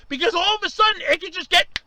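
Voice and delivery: ordinary delivery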